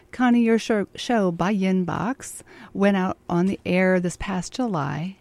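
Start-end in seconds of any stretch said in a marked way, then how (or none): none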